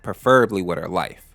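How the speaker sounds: usual speaking voice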